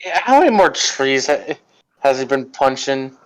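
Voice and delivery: ordinary talk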